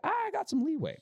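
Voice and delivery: high, airy mock-relaxed voice; mock carefree. Telling friends about the airport trip, high breezy mock calm. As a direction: play it breezy, like there's all the time in the world. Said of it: "So I was like".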